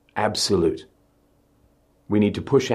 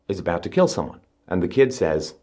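ordinary talk, nothing marked

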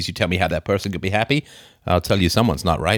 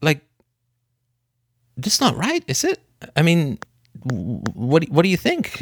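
higher, questioning son in a role play; role-played hurt son; 6 semitones higher than usual. Son asking dad what he thinks, role-played hurt. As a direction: press dad for an answer, pitch lifted. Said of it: "I say, I won't do your accent".

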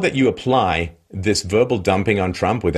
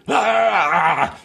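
mock frustrated yell; 9 semitones higher than usual. Ranting owner's outburst.

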